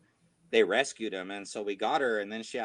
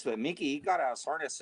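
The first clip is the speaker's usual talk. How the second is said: usual speaking voice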